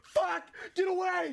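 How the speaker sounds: high, hammed-up furious screaming; Jolly Green Giant. Giant chased by kids, very high mock-furious shouting. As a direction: swear at pursuers in mock panic and rage. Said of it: "kids are chasing the Jolly Green Giant".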